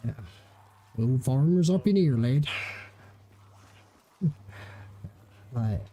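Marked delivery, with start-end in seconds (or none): 1.0-2.5 rustic English farmer accent again on request. Shown as a demonstration. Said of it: "do the farmers have, like, a certain accent?".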